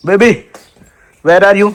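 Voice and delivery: calling out. Real, not acted. Calling out to find someone outdoors.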